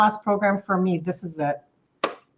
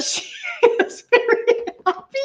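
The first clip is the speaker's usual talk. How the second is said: laughing. Real, not acted. Laughing over viewer's happiness.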